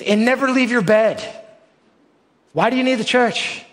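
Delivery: mock-defiant high question; rhetorical challenge.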